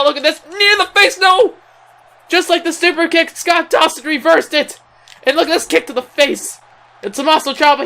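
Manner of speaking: high, urgent play-by-play at the climax; excited match call. Real, not acted. Commentator calling the knee and cover to viewers, excited. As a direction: pitch it high and excited, riding the action.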